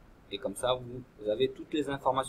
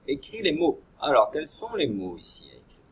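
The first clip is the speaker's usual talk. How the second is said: usual speaking voice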